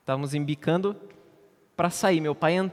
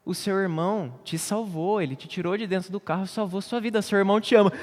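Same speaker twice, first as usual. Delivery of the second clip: higher, gently slowed mother's voice; mother consoling her son after the robbery, higher gentle voice; comforting mother; 7 semitones higher than usual. Retold or imitated.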